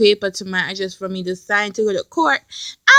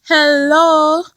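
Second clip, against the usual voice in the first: higher, stretched-out panicky hello; panicked; 5 semitones higher, 4 dB louder than usual. Retold or imitated. Calling back about the voicemail, mock panicked. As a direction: reenact a worried greeting on the phone. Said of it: "like, panicking".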